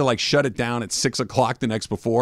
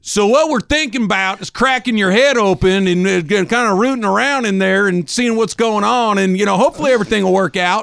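put-on Southern accent; 7 semitones higher, 4 dB louder than usual. Surgeon explaining brain surgery to patient, Southern accent. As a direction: ham up a folksy Southern surgeon. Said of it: "that Southern accent".

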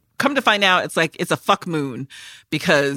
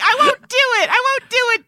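very high, loud squeaky refusal; shrill refusal; 4 dB louder than usual. Retold or imitated. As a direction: play a frightened woman digging in her heels. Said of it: "She's like".

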